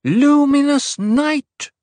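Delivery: high, loud, drawn-out proclamation; ringing oracle's cry; booming cry. A narrator's reading. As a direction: cry it out slowly across a vast nave. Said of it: "Her amplified voice boomed across the nave".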